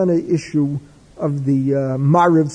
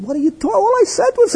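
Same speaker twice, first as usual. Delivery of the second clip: much higher, an innocent what-did-I-say plea; hammed-up indignant innocence; defensive protest; 13 semitones higher, faster than usual.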